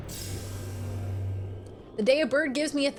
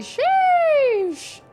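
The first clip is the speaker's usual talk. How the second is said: high, loud, drawn-out sheesh; stretched sheesh; 7 dB louder than usual. Real, not acted. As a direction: stretch it out, impressed. Reacting to a big win.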